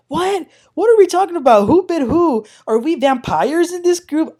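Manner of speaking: high, loud, disbelieving questions; baffled Kiki. Retold or imitated. Recapper voicing Kiki's confused confessional, high and baffled. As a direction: act totally lost in the fight. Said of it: "She was so confused".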